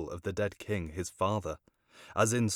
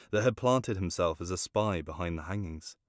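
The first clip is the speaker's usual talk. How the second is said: usual speaking voice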